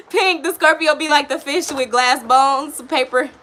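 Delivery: amused loud laughing delivery. Real, not acted. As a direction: crack up while explaining. Laughing at reading.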